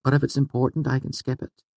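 high, light female character voice; young woman's voice. A narrator's reading. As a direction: agree in a lifted woman's voice. Narrator voices Fela agreeing.

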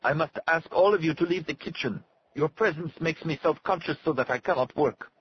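high-pitched, irritated character voice; cross. A narrator's reading. Cook orders onlookers out of his kitchen, cross. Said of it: "Helio said in a cross voice".

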